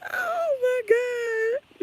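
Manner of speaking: very high, drawn-out whine; high mock-crying groan; exasperated wail. Real, not acted. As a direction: wail it slowly in a squeaky voice. Exclaims after a laughing fit.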